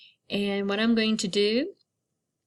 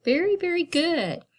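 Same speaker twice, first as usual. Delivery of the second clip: high, bright praise for the class; high praise to little learners; excited praise; 8 semitones higher than usual. Real, not acted. Teacher praises children's rhyming.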